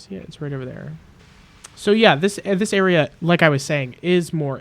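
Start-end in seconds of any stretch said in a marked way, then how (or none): 1.8-4.6 suddenly loud. Real, not acted. Suddenly loud explaining to viewers.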